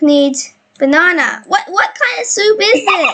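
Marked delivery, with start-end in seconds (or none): 1.5-3.1 high-pitched, laughing question; amused disbelief. Real, not acted. Player baffled by banana in the soup, laughing disbelief. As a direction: break into laughter while asking.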